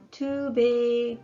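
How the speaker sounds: ordinary delivery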